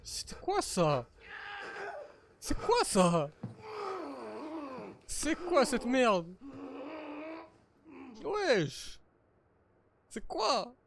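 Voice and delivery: high-pitched alarmed protest; spooked, high-pitched disbelief; freaked out. Real, not acted. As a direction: recoil from something creepy, voice jumping up high. Creepy horror game scare, refuses to go.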